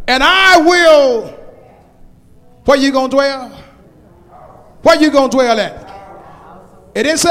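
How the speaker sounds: high, loud psalm climax with congregational asides; full-force preaching. Acted. Preacher telling where he will dwell, full-force preaching. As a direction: build to a ringing cry on the scripture.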